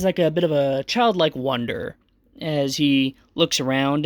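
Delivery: ordinary talk, nothing marked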